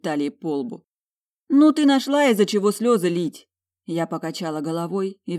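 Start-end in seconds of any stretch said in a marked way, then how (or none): 1.5-3.5 high, loud reproach; exasperated scolding. A narrator's reading. Scolding her sister for crying over rags, exasperated.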